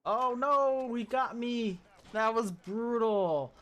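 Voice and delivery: loud, high, anguished yell; real frustrated outcry. Real, not acted. Streamer just killed from behind, loud pained outcry. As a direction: cry out as the shot lands.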